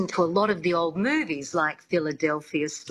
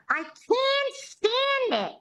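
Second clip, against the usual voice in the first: very high, slow, grating starlet voice; high nasal-free squeaky diva complaint; Lina Lamont whine; 14 semitones higher than usual. Retold or imitated. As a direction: squeal it like a tone-deaf silent film star. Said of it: "very famously says".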